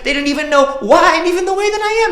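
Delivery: high, loud, fast rush of words; impassioned outpouring. Acted. Student blurting out her feelings to the teacher, impassioned. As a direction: pour it all out at full volume. Performs poem at full force.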